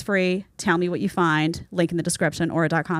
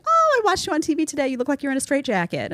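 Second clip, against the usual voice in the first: mom's voice; 7 semitones higher than usual. Retold or imitated. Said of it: "My mom used to tell me".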